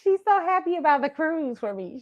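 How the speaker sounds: ordinary delivery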